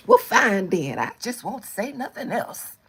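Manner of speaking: put-on loud huffy old-lady sulk; mock offended. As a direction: fake hurt to guilt-trip. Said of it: "Then they gonna reverse psychology".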